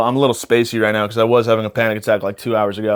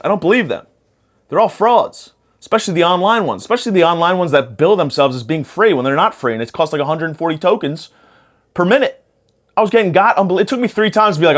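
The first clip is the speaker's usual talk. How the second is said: worked-up rant; 6 semitones higher than usual. Real, not acted. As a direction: get fired up about the scam. Heated rant about psychic scams.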